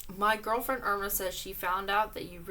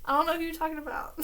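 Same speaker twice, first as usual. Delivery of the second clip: laughing; 8 semitones higher, much faster than usual. Real, not acted. Reader laughing at fan letter.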